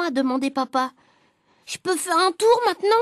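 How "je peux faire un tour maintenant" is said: put-on higher, eager little-boy request; lightly voiced impatient boy; pleading child. A narrator's reading. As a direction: pipe up hopefully, pitched high. Child begs for a turn.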